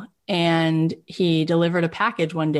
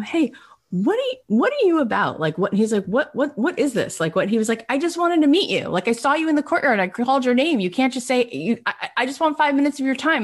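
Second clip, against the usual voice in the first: high, fast eager pitch; excited; 8 semitones higher than usual. Retold or imitated. Brian pitching himself to Ron, high and excited. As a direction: rush it out eagerly.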